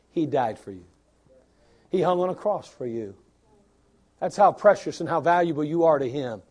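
ordinary talk, nothing marked

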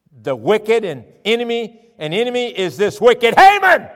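queen accusing the villain before the king. Acted. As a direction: point him out and condemn him. Esther accuses Haman.

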